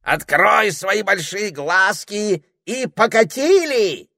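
high, loud wake-up call; крик. A narrator's reading. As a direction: shout slowly to rouse a sleeper. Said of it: "закричал он". (громкий протяжный крик)

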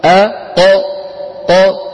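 أ ق split, the qaf loud and bouncing; loud sprung ق after أ; qalqala demo. Shown as a demonstration. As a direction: break أق apart and spring the ق. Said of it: "فبدل أن يقول أق يقول أق".

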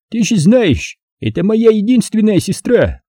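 high-pitched, resolute character voice; protective brother. A narrator's reading. Vakha refuses to give up his sister, resolute.

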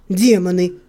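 curt curse. A narrator's reading. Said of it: "кратко ругнулся Себастьян".

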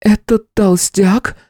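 high, slow startled outcry; surprised cry. A narrator's reading. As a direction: blurt it out in shock. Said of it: "Женщина не удержалась и вскрикнула".

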